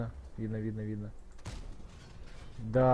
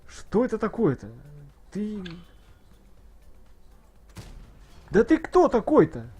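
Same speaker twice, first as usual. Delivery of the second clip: high, loud exasperated yelling; excited yelling; 7 semitones higher, 10 dB louder than usual. Real, not acted. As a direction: yell it in disbelief. Stream outburst at enemy.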